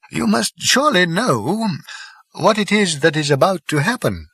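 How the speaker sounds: put-on a wise old planet-builder explaining gravely; elderly Slartibartfast.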